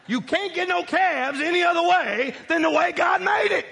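loud, high pulpit shout; fiery sermon climax; preaching at full force. Real, not acted. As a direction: drive the point home to the congregation.

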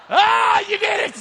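high, loud cheer; excited shout. Real, not acted. Pastor cheers the congregation for the line, excited shout. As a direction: celebrate the crowd's answer. Crowd answers his line.

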